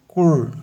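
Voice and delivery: isolated, emphatic CUR; stressed syllable alone. Shown as a demonstration. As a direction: punch out a lone "cur". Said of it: "a sílaba tônica é concur".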